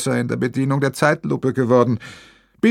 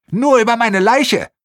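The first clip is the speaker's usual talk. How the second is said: much higher, loud snapping; defiant shriek; 11 semitones higher, 5 dB louder than usual. Acted. Protester defies the demolition crew, high and loud. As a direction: hurl the defiance at him. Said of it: "keift die Demonstrantin".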